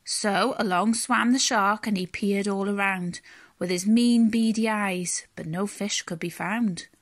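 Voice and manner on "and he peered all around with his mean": plain reading voice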